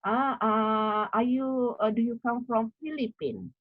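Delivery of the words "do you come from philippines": usual speaking voice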